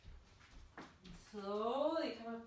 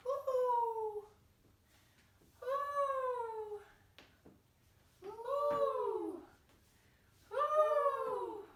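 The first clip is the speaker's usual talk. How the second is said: much higher, loud owl hoot; playful, sustained owl call; 7 dB louder, much slower than usual. Pretend. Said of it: "we're gonna be owls".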